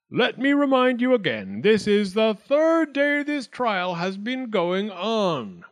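high, slow, loud pronouncement; declaring. A narrator's reading. Wildcat addresses the quieted court, declaring high and slow. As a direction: stretch it out with official weight. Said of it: "Wildcat declared".

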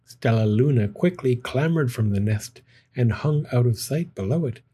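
usual speaking voice

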